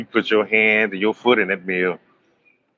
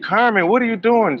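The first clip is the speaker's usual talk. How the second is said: startled; 10 semitones higher than usual. Retold or imitated. Startled at Carmen's act.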